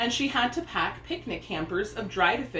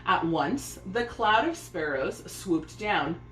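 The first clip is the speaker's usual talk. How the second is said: plain reading voice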